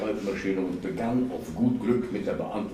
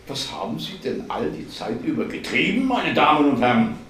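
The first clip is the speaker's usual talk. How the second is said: higher, declaimed mock reproach; 5 semitones higher, 5 dB louder than usual. A narrator's reading. Martin imagines officials scolding the department, mock reproach. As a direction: swell to a loud finish.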